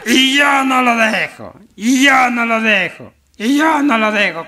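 high, drawn-out hollered refrain; drunken shouting. Acted. Drunk clinging to a post, high drawn-out shouting. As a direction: holler it stubbornly, stretching the words. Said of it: "Y gritaba".

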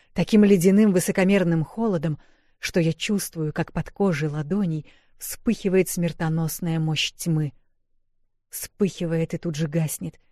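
ordinary delivery, nothing marked

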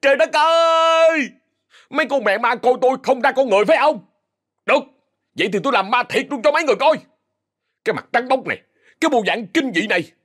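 high, furious ranting; loud outburst. Acted. Boy ghost raging at the other ghosts, high and furious. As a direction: rage at the ghosts, fast and high. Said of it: "thằng Trợn lớn tiếng".

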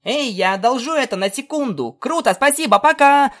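hasty excited. Acted. Scootaloo grabs foal, runs off.

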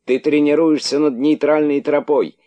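"тренируешься" yelled high and loud in outrage; high furious accusing cry; indignant shout. A narrator's reading. Outraged character keeps shouting.

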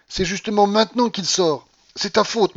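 high, loud accusing cry. A narrator's reading. Grandmother blaming her companion for the loss, crying out. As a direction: burst out and lay blame. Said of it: "cria-t-elle".

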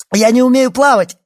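very high, loud cry of terror; frantic cry for rescue; panicked shout. A narrator's reading. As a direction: scream it like someone drowning. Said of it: "крикнула она".

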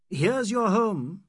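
high, slow, bitter mockery; sardonic. A narrator's reading. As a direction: sneer it out slowly. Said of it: "he said sardonically".

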